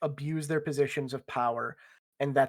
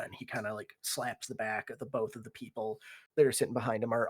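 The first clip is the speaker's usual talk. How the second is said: ordinary talk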